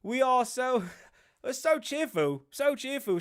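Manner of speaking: high-pitched, hammed-up happiness; mock a prince insisting he is delighted; put-on, over-cheerful prince voice; Harry impression. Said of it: "I'll be Harry".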